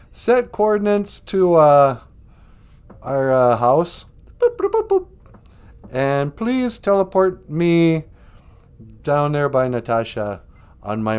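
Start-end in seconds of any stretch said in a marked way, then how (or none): 4.4-5.1 very high mock computer beeps. Calling the spaceship computer with high mock beeps.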